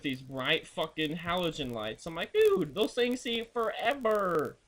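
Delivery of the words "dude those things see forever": higher, slow, drawn-out exclaim; retold exasperated call to a clueless driver; mock exclaim. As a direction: stretch it out like you can't believe them.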